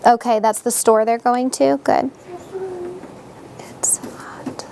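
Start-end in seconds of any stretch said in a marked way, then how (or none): 3.8-4.7 breathy unvoiced whisper, barely there. Real, not acted. Child murmurs to herself while drawing, whispered.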